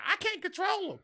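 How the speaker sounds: a high, exasperated mother; imitating the mother. Terry's mom giving up on him, mock high voice. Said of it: "She was like".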